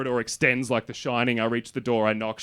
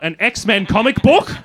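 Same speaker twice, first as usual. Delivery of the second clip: baffled; 9 semitones higher, 12 dB louder, slower than usual. Real, not acted. Baffled at the drawer contents.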